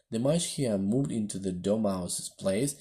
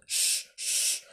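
hushed whispered shush. A narrator's reading. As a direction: shush someone sharply. Hatter and Hare hush Alice.

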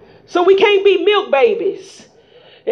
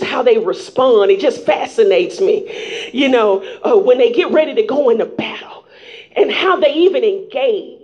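ordinary talk

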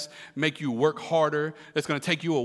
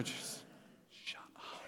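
barely audible, voiceless whisper; 23 dB quieter, slower than usual. Acted. Preacher hinting at the parents' word, whispering. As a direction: slip it out under your breath.

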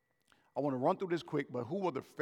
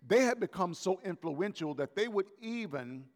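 ordinary delivery

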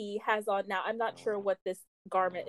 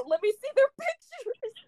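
high-pitched eager mother voice; comic retold nosy mother; mom. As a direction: imitate a keen mom, pitched way up. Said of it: "the mom goes".

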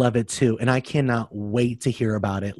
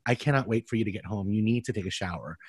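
softer, quicker private warning; hushed quote; 5 semitones lower, 9 dB quieter, faster than usual. Retold or imitated. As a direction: say it low so only she hears. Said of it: "the first thing I said to her was".

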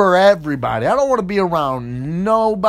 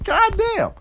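way up high in a squeaky put-on voice; sudden falsetto disbelief; high mock squeal; 13 semitones higher than usual. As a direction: squeal it like a shocked cartoon. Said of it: "I'm like".

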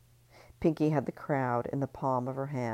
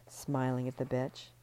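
ordinary delivery, nothing marked

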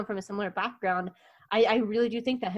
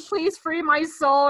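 pleading soul; 7 semitones higher, 5 dB louder, slower than usual. Retold or imitated. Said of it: "He's like".

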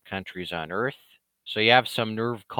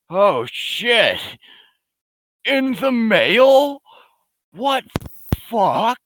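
high, loud mock outrage; hammed-up shock; 13 semitones higher, 8 dB louder, much slower than usual. Mocking the trolls' news, in high, loud fake shock. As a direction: squeal it like the news is a scandal.

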